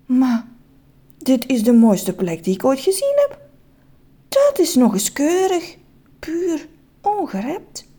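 marvel, slowing on the last words; wonder. Acted. Man seeing the most beautiful place, mild wonder. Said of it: "Hij keek vol verwondering om zich heen".

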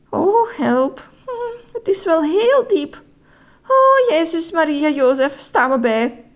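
high, anxious pleading; nervous dread. Acted. Nervous man peers down the shaft.

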